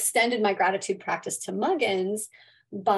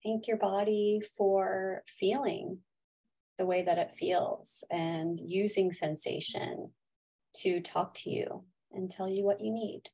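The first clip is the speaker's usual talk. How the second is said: guided meditation; 5 dB quieter than usual. Acted. Leads a gratitude meditation.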